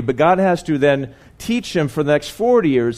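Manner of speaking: ordinary talk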